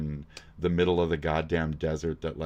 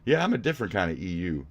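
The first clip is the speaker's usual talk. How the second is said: ordinary talk, nothing marked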